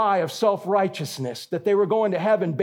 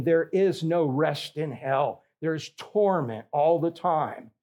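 ordinary talk, nothing marked

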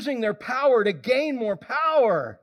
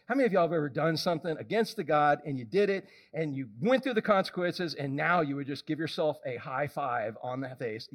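ordinary delivery

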